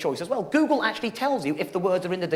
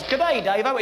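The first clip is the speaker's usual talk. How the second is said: put-on Australian accent; 6 semitones higher, 4 dB louder, slower than usual. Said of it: "I think he's Australian".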